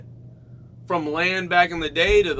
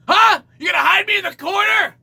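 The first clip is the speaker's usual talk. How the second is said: building from indignant to shouted higher; indignant outburst; 5 semitones higher, 4 dB louder than usual. Retold or imitated. Customer yells at the waiter about the corner, indignant shouting. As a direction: take offense and blow up. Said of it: "I'd be like".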